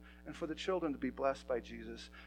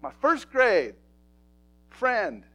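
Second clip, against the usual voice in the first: shouted preaching; 11 semitones higher, 22 dB louder than usual. Acted. Pastor tells the church heaven is full of kids, shouting. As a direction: roar it out to the back row.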